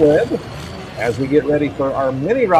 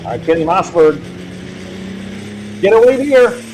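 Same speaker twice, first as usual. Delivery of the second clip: tractor pull announcer. Real, not acted. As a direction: call the hook out over the crowd. Live tractor pull call.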